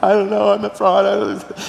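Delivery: high-pitched tearful wail, acted out; mock sobbing. Pastor confesses to his friend, imitated convulsive sobbing. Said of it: "just convulsing in tears".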